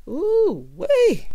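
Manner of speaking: high, loud, drawn-out exclamation; scandalized whoop. Real, not acted. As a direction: whoop at the juicy drama. Reacting to the heated retold quarrel.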